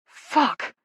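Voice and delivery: gasped curse. A narrator's reading. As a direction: let it out on a gasp. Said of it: "he gasps".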